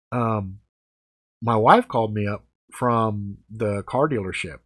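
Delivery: normal talking voice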